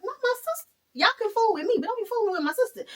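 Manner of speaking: mock fury defending her sister; protective outburst. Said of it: "Fifi is like".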